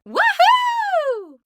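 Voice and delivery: very high loud whoop. A narrator's reading. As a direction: cheer with joy. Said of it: "what he thought was".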